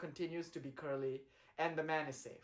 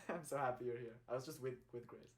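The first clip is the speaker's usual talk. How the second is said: ordinary delivery, nothing marked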